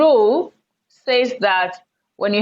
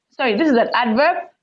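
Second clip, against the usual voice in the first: ordinary talk, nothing marked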